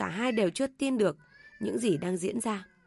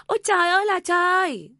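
full-blown wailing scream; 10 semitones higher, 9 dB louder than usual. A narrator's reading. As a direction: scream to heaven in outrage. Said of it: "Bà Tâm lại gào to hơn". (gào thật to, kéo dài than trời)

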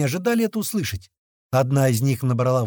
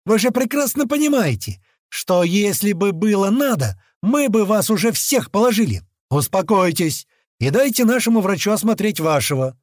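raised, high-pitched forceful appeal; shouted warning; 7 semitones higher, 4 dB louder than usual. A narrator's reading. Man warning monkeys to let the doctor work, forceful shouting. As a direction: press the warning home loudly. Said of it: "крикнул я обезьянам".

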